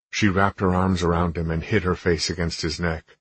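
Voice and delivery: plain reading voice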